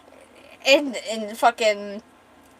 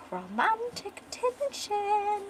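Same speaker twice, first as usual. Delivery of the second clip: high, slow, softer drawn-out tease; teasing singsong; 10 semitones higher, 7 dB quieter, much slower than usual. Acted. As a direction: stretch each syllable in a mocking coo. Jasper teases while holding back laughter.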